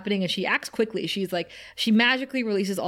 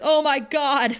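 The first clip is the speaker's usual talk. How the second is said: panicked gasp; 6 semitones higher, 5 dB louder, slower than usual. Retold or imitated. Xander sees demon unchained.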